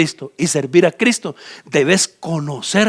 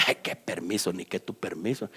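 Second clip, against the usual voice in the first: scornful king; 12 semitones lower, 16 dB quieter than usual. Acted. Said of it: "Balac dice".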